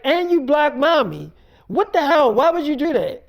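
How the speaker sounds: high, loud accusing questions; heated mock reproach; retold indignation. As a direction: confront her, imitating an outraged relative. Retold wife challenged about blocking family.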